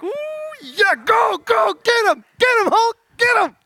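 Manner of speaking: high cheering shout; cheering kid. Retold or imitated. Pastor reliving childhood TV cheering, loud excited shout. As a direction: yell like a kid egging on the Hulk. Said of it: "You're like".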